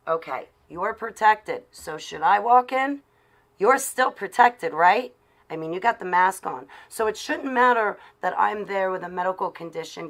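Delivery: loud, high-pitched indignant arguing; heated challenge. Real, not acted. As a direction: press the point loudly, demanding an answer. Heated argument against mask wearers.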